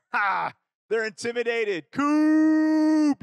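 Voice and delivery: gloating, high and loud; cocky gloat. Retold or imitated. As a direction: crow over the scared rivals. Cocky boast about rivals.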